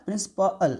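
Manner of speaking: प्रिंसिपल split as "prins-pa-al"; syllable breakdown. Shown as a demonstration. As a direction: separate the final "a-al" in प्रिंसिपल. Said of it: "अ आ रहा है". (प्रिंसिपल तोड़कर "प्रिंस-प-अल")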